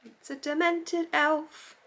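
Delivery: high, slow amused announcement; mock grave elf verdict; amused reveal. Real, not acted. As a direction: draw it out, tickled by the odd shape. Reveals odd advent chocolate shape.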